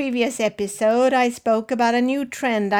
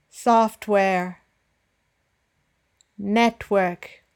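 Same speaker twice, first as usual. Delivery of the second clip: BLUEtooth, BROWser, DRIver, SOFTware, NETwork, each stressed up front; careful listen-and-repeat terms, first syllable leading; slower than usual. Shown as a demonstration. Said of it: "by simply stressing the first syllable".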